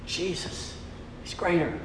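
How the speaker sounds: Jesus is greater breathed as a hushed whisper. Acted. Preacher hushes the congregation, whispering Jesus is greater. Preacher repeating church theme.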